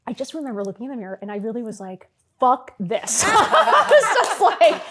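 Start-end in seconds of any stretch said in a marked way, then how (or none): 2.4-3.2 laughing exasperation. Retold or imitated. Laughing, fed up at mirror. 3.2-4.8 a big high burst of laughter breaking into the line; real high laugh. Real, not acted. Bursts out laughing retelling moment.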